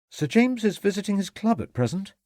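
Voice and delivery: lofty butler, pitched high; superior tone. A narrator's reading. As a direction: look down on the visitor. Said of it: "replied Saunders in a superior tone".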